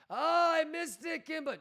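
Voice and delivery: hammed-up nervous whimper; whiny mock panic. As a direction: imitate a panicking viewer pestering the streamer. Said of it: "are freaking out".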